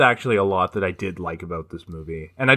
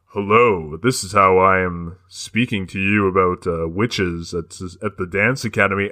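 lightly imitating a smooth dubbed announcer; mock the bland dubbed voice, slowing on the witches; dubbed American voice. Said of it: "he starts talking and it's like".

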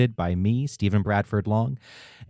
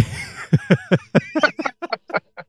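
high burst of laughter; big laugh; 12 semitones higher than usual. Real, not acted. Laughing at own story.

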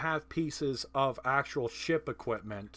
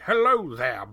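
loud, higher-pitched pirate greeting; pirate accent; 3 semitones higher, 10 dB louder than usual. Acted. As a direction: belt it out in a hammy pirate accent. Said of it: "a pirate accent going like".

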